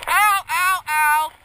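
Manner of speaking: sharp pained ow cries; pained yelps. Real, not acted. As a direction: yelp in real pain. Leg crushed under hay bale.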